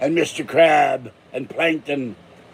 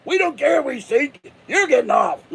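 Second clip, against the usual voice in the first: shouted pirate order; 10 semitones higher than usual. Acted. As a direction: yell it at him from the deck. They said.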